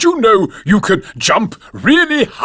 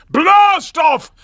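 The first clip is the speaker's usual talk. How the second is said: big raised-pitch shouted call; rousing shout; 4 semitones higher than usual. Acted. Grampy Rabbit teaching children the launch, rousing shout. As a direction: shout it out to rouse a crowd. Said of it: "then you have to shout".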